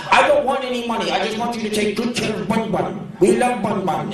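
high, fast-then-gushing lady voice; crazy lady voice. Retold or imitated. Crazy woman begging the shop to keep her rabbit. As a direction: gush over the rabbit like the nutty owner.

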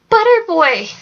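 high call from across the camp; high-pitched distant yell. A narrator's reading. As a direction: holler his nickname, pitch jumping up. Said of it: "Trobel yelled from across".

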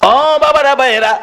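high, full-force exhortation; fervent preaching. Real, not acted. Preacher appeals to fathers and brothers, fervent exhortation. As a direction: appeal to the crowd high, then settle.

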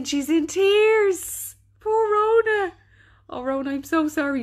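Poor Rona cooed way up high; exaggerated pity. Real, not acted. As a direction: coo over her like a tender aunt. Sympathising with a crying viewer.

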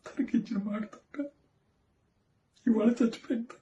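high, soft, tearful breaking voice, rising as he breaks down; father confessing to the judge, voice cracking into tears. Acted. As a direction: choke up as the guilt overwhelms him.

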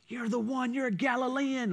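lightly put-on Texan twang; playful Texan accent. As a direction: accuse with a hint of Texan. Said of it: "He had, like, a Texan accent".

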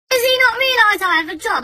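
high-pitched exasperated question; indignant complaint. Retold or imitated. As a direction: complain in outraged disbelief. Indignant complaint about neighbour.

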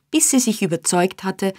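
ordinary delivery, nothing marked

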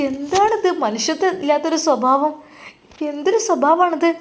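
high wailing mother's plea; crying mother. Acted. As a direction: cry out in pain as the beaten mother. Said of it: "ഉമ്മ അവിടെ നിന്ന് ഉറക്കെ കരയുകയാണ്".

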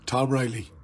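ordinary talk

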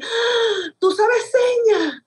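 high, slow joyful exclamation; light up with surprise at finding a signer; delighted surprise. Retold or imitated. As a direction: deaf woman thrilled someone signs. Deaf woman, delighted surprise.

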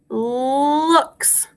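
LOOKS with a long held L; drawn-out first sound. Shown as a demonstration. Teacher shows class the L sound, LOOKS with the L stretched. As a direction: hold the L before finishing "Looks". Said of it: "The L sound".

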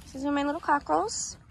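normal talking voice

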